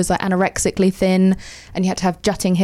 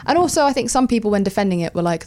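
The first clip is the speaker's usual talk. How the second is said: ordinary talk, nothing marked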